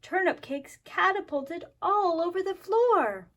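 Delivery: usual speaking voice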